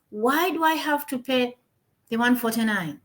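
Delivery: ordinary delivery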